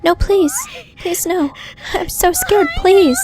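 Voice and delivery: high, begging in fear; terrified pleading. Acted. Character pleading in terror.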